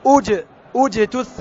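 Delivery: اجتثت begun with u, said slowly; slow model start. Shown as a demonstration. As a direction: restart on ujtuththat. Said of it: "يبدأ بها بالضم".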